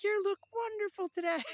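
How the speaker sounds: very high, loud, laughing shout; mock elation dissolving into laughter; hammed-up delight. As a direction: gush it, cracking up as you go. Acted elation, breaking into laughter.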